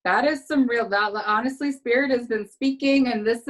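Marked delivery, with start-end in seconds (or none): none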